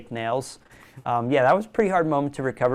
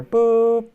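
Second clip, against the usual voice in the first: high electronic beeping sound; alarm beep imitation; 9 semitones higher, 7 dB louder than usual. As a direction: mimic a fire alarm beep.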